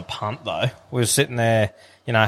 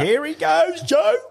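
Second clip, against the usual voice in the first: much higher, thrilled cheer; excited cheer; slower than usual. Retold or imitated. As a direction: cheer the horse on like it's already won. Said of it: "I was like".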